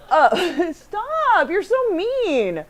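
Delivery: high laughing plea, half crying; playful laughing complaint to a teasing co-host; laughing protest. Real, not acted. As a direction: laugh through stop you're so mean.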